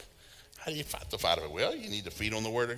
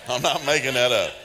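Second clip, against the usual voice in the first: louder, laughing protest; loud laughing promise; loud insistence; 4 semitones higher, 7 dB louder than usual. Real, not acted. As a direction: insist it's true over the laughter. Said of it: "insisting through laughter".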